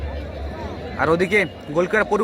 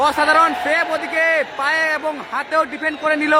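excited shout; 5 semitones higher than usual. Real, not acted. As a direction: shout the big save. Winning save, shouted call.

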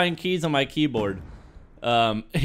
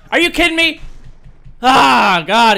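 frustrated outburst; 8 semitones higher, 9 dB louder than usual. Real, not acted. Killed again, frustrated.

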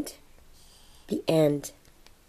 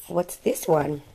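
usual speaking voice

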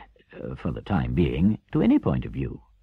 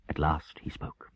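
ordinary delivery, nothing marked

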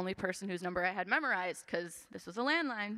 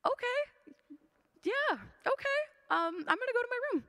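put-on much higher, a small kid awkwardly backing out of the room; young kid voice. Sixth grader answering his stressed mom, high and awkward. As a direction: play the sixth grader nodding along, a bit quicker, wanting to escape. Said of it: "I'm just a sixth grader".

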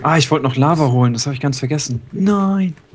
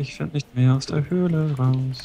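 mock lament; 3 semitones higher, 4 dB quieter, slower than usual. Repeats the lament.

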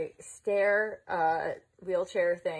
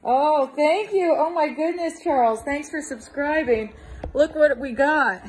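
very loud, higher-pitched, effusive holler; calling out; 6 semitones higher, 12 dB louder than usual. Real, not acted. Vlogger thanking Carlos and showing off the gift, calling out. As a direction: project your thanks across a distance. Talking loudly across to Carlos.

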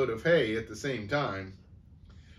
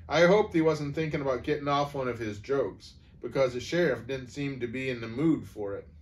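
ordinary delivery, nothing marked

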